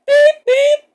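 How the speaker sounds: high loud beep beep; engine beep. Acted. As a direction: toot like a train. Engine whistle imitation.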